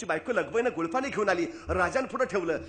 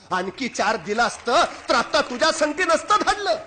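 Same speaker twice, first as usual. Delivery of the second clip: loud, high, fiery declaration; a mother ready to give more sons; proud defiant mother; 10 semitones higher, 10 dB louder than usual. Acted. Mother proclaims she'd send more sons. (अभिमानी आईचा मोठ्याने निर्धार)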